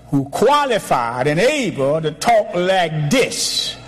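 "brag" पर ज़ोर देते हुए धीमा, ऊँचा होता प्रचार; preaching. Acted. Preaching at full force.

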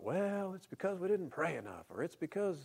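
high, soft mocking excuses; mocking whine. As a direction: mimic lame excuse makers.

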